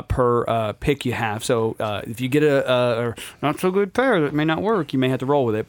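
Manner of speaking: ordinary delivery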